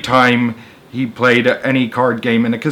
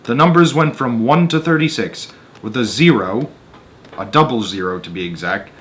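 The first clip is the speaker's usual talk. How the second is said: measured read-aloud narrator voice; story narration; 3 semitones higher than usual. A narrator's reading. Reading a Roald Dahl story to an audience, storyteller voice. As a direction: tell the tale steadily to listeners.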